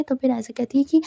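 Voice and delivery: plain reading voice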